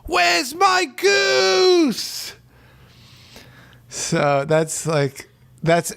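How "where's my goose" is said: very high, drawn-out yell; mock outraged shout. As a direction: wail it slowly into the air. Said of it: "and the guy's like".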